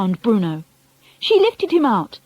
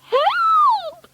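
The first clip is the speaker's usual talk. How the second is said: piercingly high, drawn-out cry for help; screamed. A narrator's reading. Wife panics over the mouse, terrified scream. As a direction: scream it in terror. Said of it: "screamed Missus Jenkins".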